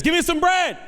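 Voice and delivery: high, loud demand; calling out. Acted. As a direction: call through a closed door to a neighbor. Neighbor calling for bread at night.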